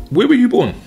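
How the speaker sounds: normal talking voice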